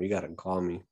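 ordinary delivery

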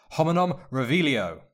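loud, high incantation; spell shout. A narrator's reading. Harry reveals hidden spy, commanding spell shout. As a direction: cast the spell with force.

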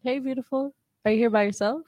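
ordinary talk, nothing marked

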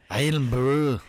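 punchy mock Scottish burr; Scottish accent. Shown as a demonstration. Co-host joins the accent game, mock Scottish. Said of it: "do a bit of the Scottish R's".

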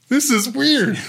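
high, puzzled other person; mock bewildered friend; surprised quote. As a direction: voice them high and baffled. Retelling others' reaction.

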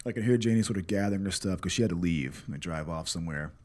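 ordinary talk, nothing marked

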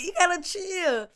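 high, hushed scolding, a laugh slipping in; embarrassed preteen. Retold or imitated. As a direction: hush the little niece in front of friends. Telling the niece to calm down.

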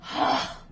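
short breathy gasp, acted out; mock gasp. Podcaster acting out a movie escape, mock gasp. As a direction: gasp as if you are the escapee. Retelling a character's desperate escape.

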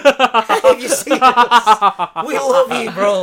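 mock plea. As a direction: beg a celebrity, half joking. Calling out to E-40 for sponsorship.

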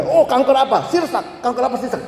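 high, animated imitation of papa; eager advice-giving papa. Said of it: "ya papa saya dulu suka gitu ya".

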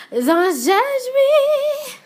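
very high, drawn-out wail with a laugh; squealed mock pleading; embarrassed playful plea. Real, not acted. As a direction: whine it loud and long, half laughing. Embarrassed plea after confiding.

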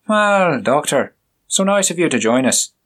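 the inspector, curt and scornful; stern inspector. Acted. Voiced inspector confronting the doctor.

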